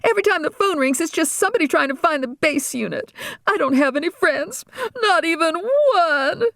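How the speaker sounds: crying. Acted. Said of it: "Regina cried with her mouth full".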